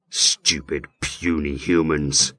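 low, slow demon voice; dragon voice. Acted. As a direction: speak as an ancient hellish creature. Demon speaks through him.

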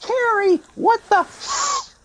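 very high, squawking yell from afar; Crawford's distinctive voice. Retold or imitated. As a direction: shriek it across the rink in mock outrage. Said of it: "I hear Crow with that distinctive voice".

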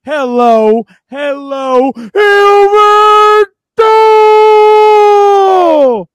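booming hype-man entrance, slow and loud; berserk rage. Acted. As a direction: yell it like a wrestling entrance. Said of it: "people told you to stop yelling".

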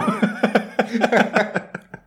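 a burst of high laughter; hearty laugh. Real, not acted. Joke about the song results.

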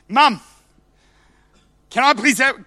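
put-on a three-year-old whining for cookies, high and loud; pushy little kid asking; demanding toddler. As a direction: toddler demanding from his mum. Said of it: "he wouldn't have said please".

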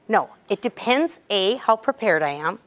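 ordinary talk

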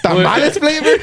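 high, laughing disbelief; surprised question. Retold or imitated. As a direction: ask it in amused disbelief. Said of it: "I was like".

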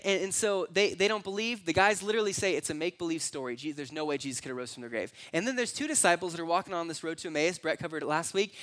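ordinary delivery

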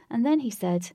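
usual speaking voice

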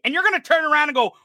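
usual speaking voice

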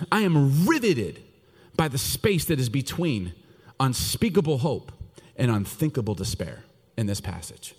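usual speaking voice

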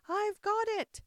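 high, loud exclamation of triumph; triumphant cry. A narrator's reading. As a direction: crow it out in victory. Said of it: "she cried in a triumphant tone".

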